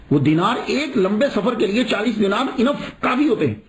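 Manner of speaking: ordinary talk, nothing marked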